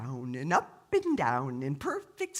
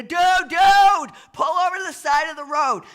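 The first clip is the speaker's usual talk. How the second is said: high, loud shouted plea; urgent yell; 13 semitones higher, 15 dB louder than usual. Acted. Biker heroine yells at the stoned driver, high shout. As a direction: yell it high at full volume. Linda yelling at Marshall to stop.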